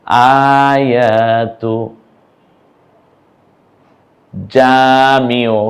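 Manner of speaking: Shahida stretched syllable by syllable, each vowel held long; slow drawn-out reading. Shown as a demonstration. Teacher to learners, showing the joined word, very slow. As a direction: draw out Shahida slowly and clearly. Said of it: "তকে পেশ দিয়ে তু".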